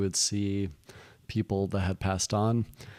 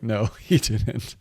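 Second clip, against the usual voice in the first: laughing reply; much slower than usual. Real, not acted. Laughing at the mishap.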